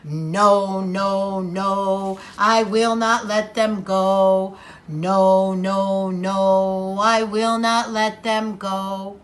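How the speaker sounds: low sing-song refusal; Pharaoh refusing. Acted. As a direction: chant the no's low and stubborn. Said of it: "He said".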